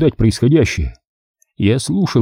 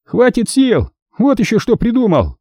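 mocking echo, much higher voice, хватит stressed; open mockery; 12 semitones higher than usual. A narrator's reading. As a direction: sneer the words back at him. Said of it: "Гальс не скрывал издёвки".

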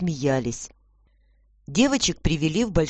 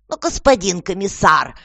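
raised, insistent protest; demanding; 4 semitones higher, 6 dB louder than usual. A narrator's reading. Lady to the commissioner, demanding. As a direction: press the official, higher and louder. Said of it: "требовательно говорила её светлость".

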